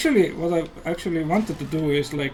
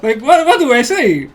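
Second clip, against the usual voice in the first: loud exclaiming; 7 semitones higher, 9 dB louder than usual. Real, not acted. Laughing panic about speaking English.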